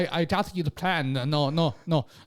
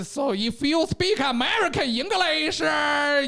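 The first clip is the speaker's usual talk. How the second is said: Chinese English teacher; 12 semitones higher than usual. Retold or imitated. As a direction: address the class like an eager teacher. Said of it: "that lady was like".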